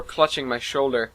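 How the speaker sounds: ordinary delivery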